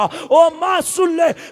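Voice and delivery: son calling loudly through the door, high and loud. Acted. Son calls to his mother through the door, loud.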